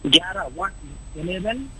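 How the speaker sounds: faint, broken phone line; fading out over weak network; bad connection. Real, not acted. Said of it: "आवाज़ संपर्क में हमारे नहीं आ पा रही है". (नेटवर्क में कटती आवाज़)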